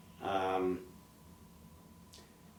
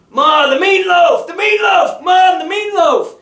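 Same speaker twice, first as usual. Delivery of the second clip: much higher, screamed at full volume; mock frantic yell; 15 dB louder than usual. Sibling yelling to mom about the meatloaf, screamed high. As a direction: scream it across the house. Mocking family shouting at mom.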